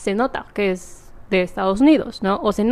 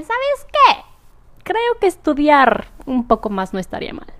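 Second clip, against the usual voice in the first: sudden bright idea; 6 semitones higher than usual. Retold or imitated. Retold change of mind about studying.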